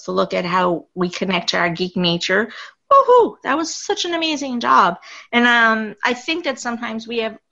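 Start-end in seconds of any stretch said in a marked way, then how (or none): 2.9-5.0 loud, high whoop of joy; a big happy woohoo; excited cheer. Real, not acted. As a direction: cheer the guests on. Cheering the guests' great job.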